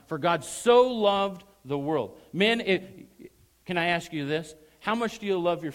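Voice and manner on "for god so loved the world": "so" very loud, high and stretched; stress on so. Shown as a demonstration. Preacher driving the emphasis home to the congregation, big drawn-out stress on "so". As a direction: belt out a long, emphatic "so". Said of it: "emphasize so in your mind".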